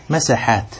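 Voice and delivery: مساحات said masaHaat, fatha on the sin; مساحات with an open a after the s. Shown as a demonstration. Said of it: "بفتح السين الذي عنده السين ساكنة".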